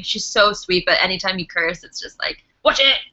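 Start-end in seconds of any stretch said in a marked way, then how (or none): 2.6-3.0 sharp stern mum warning. Retold or imitated. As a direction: snap it like a strict mother. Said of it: "Really good impression on my mum".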